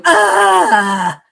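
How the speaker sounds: loud, high-pitched imitation of a tantrum wail; mock tantrum cry. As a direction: let out a loud mock-bratty "ah". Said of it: "she's just so like".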